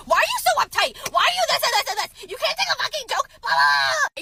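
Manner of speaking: very high, loud imitation rant; hammed-up shrieking tirade; mock yelling. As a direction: screech it like an exaggerated angry boyfriend. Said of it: "I just basically yelled at you".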